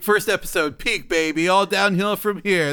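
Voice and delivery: excited. Real, not acted. Joking celebration.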